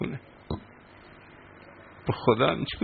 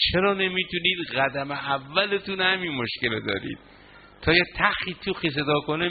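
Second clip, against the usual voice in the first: ordinary talk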